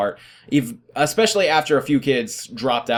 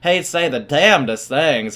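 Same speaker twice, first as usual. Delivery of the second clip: monotone teacher impression. Student showing how his favorite math teacher talks, monotone twang. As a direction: drag the words out in an old teacher's twang. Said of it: "he talked like this all the time, real monotone".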